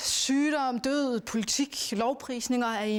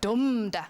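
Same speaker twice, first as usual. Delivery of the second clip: dum da with the stress on dum; trochee rhythm demo; 3 dB louder than usual. Shown as a demonstration. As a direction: chant dum da, heavy then light. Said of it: "Trokæen".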